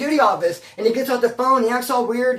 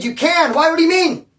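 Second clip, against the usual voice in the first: loud, higher, disbelieving; incredulous loud push; 8 semitones higher, 8 dB louder than usual. Retold or imitated. To scared cellmate, a loud incredulous retort. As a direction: push back at him in disbelief. Retold jail argument, pushing cellmate.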